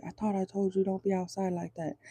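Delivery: a lightly voiced jealous boyfriend; possessive guy. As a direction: hush it like a controlling partner warning her. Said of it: "the guy's like".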